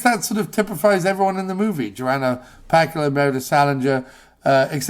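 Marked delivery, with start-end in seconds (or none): none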